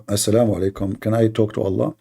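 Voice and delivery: ordinary delivery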